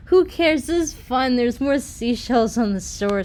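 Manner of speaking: thrilled by the big wave, cheering it on; excited. Acted. Breezy cheers on the huge wave, excited. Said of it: "said Breezy in an excited tone".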